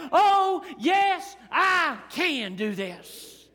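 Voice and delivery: high, rising cry of yes; a believer rising up high, then landing firm; triumphant oh yes. Acted. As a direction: answer the enemy with high triumph. Strength of God rising up.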